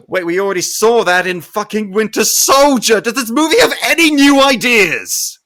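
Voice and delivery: frustrated critic boiling over at the reused twist; exasperated outburst. Real, not acted. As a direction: rant at the screen in disbelief. Exasperated rant at movie.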